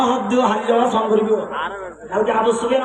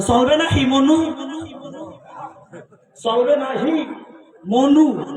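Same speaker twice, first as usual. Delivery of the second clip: চলবে না হি মনু in Barishal dialect, high and rising at মনু; 5 semitones higher, slower than usual. Shown as a demonstration. Preacher demonstrates Barishal dialect to the crowd, playful. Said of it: "এদেরকে বলতে হবে".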